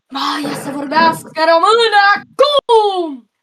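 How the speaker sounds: loud, slow incantation; magic spell shout. Pretend. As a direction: cast the spell with full force. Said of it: "să fac magia mea".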